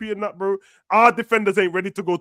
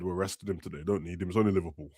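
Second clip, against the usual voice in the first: much lower and softer mocking voice; mock manager quote; 12 semitones lower, 9 dB quieter than usual. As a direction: drop low and deadpan, mimicking the manager.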